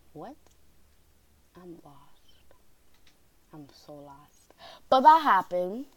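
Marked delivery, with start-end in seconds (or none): 0.1-4.5 very soft, near-whispered bewilderment; baffled hushed aside. Acted. Storyteller reacting to teacher's note, hushed bafflement. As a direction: murmur it low, utterly baffled.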